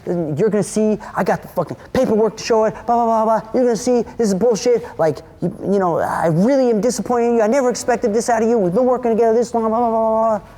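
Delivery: high, rushed imitation of an outraged man; mock indignant protest. Storyteller imitating the accused dude's protest, high and indignant. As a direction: rattle off the protest, pitched well up.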